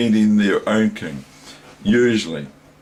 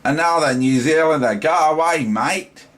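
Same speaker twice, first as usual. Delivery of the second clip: imitating a mocking Australian, pitched higher and louder; a broad Australian impression, higher and louder; Australian accent; 7 semitones higher, 4 dB louder than usual. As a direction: put on an Australian accent to shoo off a New Zealander. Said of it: "They can say".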